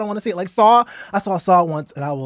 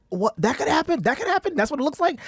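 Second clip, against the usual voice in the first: alarmed disbelief; 8 semitones higher, 3 dB quieter than usual. Acted. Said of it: "unlocks fears for me, 'cause I'm like".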